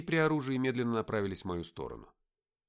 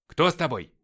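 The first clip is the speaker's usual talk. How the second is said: loud, raised sergeant's question; вопрос сержанта; 5 semitones higher, 10 dB louder than usual. A narrator's reading. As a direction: demand an answer loudly. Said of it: "спросил сержант". (громкий требовательный вопрос)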